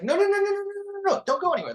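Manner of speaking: hammed-up plea to stay put; frantic media. Reporters scrambling to stop the comic, mock frantic. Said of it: "and the media's like".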